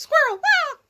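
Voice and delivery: sudden high loud yelp; startled squirrel yelp. Real, not acted. Reader spotting a squirrel, startled high yelp. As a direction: yelp at the surprise.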